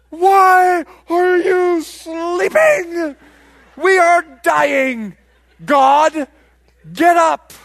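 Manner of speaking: very loud, high, drawn-out yell; hammed-up desperate bellow, word by word; panicked shout. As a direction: scream to wake someone as the boat sinks. Peter waking Jesus in storm.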